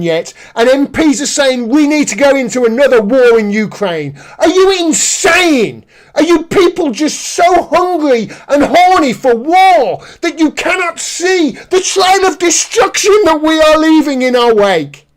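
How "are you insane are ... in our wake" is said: raised, incredulous outburst; outraged rant. Real, not acted. Commentator railing at MPs hungry for war, outraged. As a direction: demand it in high-pitched indignation.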